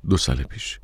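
low, softened short answer; curt reply. A narrator's reading. Musician brushing off the woman's question, curt and low. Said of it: "خیلی کوتاه جواب داد".

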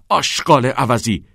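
loud, furious bellow; bellowed insult. A narrator's reading. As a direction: hurl it as a roared insult. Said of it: "چت عربده زنان گفت".

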